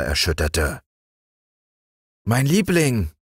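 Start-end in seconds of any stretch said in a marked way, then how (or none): 2.2-3.1 higher, concerned uncle; worried. Acted. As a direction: reach out to her with worry. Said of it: "redete der Onkel besorgt auf sie ein".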